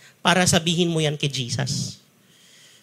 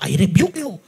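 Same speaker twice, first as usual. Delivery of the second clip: mock rebuke to child. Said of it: "Sasabihin niyo ba".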